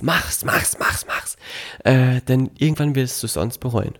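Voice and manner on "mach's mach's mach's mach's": urgent whisper. Acted. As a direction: whisper it, egging them on. Urging listener, whispered repeats.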